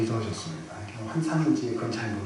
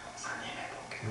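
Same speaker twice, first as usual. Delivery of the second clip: put-on child's whisper; 14 dB quieter than usual. Child promising pastor softly.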